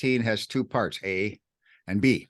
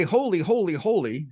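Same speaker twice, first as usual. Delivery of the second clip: first holy pitched high, the others settling; emphatic holy; 6 semitones higher than usual. Shown as a demonstration. Teacher showing triple emphasis, rising on holy. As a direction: stress each holy in turn. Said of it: "that's for emphasis".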